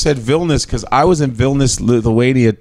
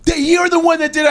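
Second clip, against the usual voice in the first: much higher-pitched accusing girl imitation; 14 semitones higher than usual. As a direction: imitate a girl pointing the blame, pitched high. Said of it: "The girl was like".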